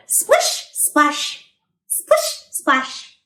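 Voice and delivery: splash with your voice; playful splish splash. Pretend. Bath time for the truck, playful splish splash.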